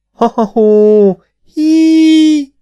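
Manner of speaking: drawn-out, very high loud laughter; echoing laughing whoop. A narrator's reading. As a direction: whoop with laughter, holding the last cry high. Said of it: "A vízhang és a szél zengett tovább".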